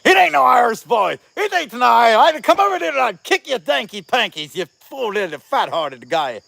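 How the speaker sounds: loud, high, blustering Irish scolding; mock Irish accent. [name] imitates an Irish commenter threatening a fight, mock Irish shout. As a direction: rant like an angry Irishman, hammed up. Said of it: "one Irish guy that's on there is like".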